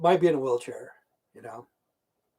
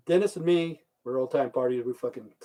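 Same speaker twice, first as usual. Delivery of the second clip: ordinary talk, nothing marked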